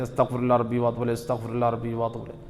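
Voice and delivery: mock hurried muttering of the prayer; rushed mumbled recitation. Shown as a demonstration. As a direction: gabble the phrase carelessly. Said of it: "بعض لوگ ہوتے جلدی جلدی پڑھتے ہیں".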